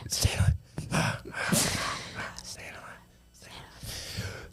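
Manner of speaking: hushed, drawn-out stay alive; whispered plea. Acted. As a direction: beg him softly not to die. Whispered plea during CPR.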